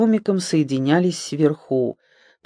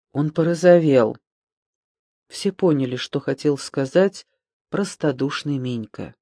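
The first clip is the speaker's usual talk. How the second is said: ordinary delivery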